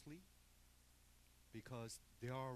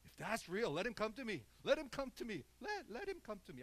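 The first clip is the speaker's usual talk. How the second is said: loud mocking challenge, higher; 9 semitones higher, 8 dB louder than usual. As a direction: jeer at God, daring him. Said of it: "mocking God".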